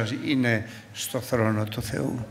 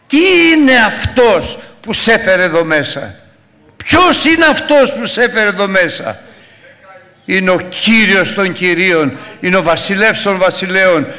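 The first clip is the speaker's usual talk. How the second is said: high, loud shouted sermon; preaching at full force; 7 semitones higher, 9 dB louder than usual. Acted. Pastor shouting God's promise to the congregation, high and loud. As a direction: thunder it out to the church.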